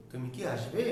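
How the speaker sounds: brief low কি, question rising on আসবে; short কি. Shown as a demonstration. Teacher asking whether you will come, short কি. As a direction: keep কি short, lift আসবে into a yes-no question. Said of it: "ছোট করে দ্রুত উচ্চারণ".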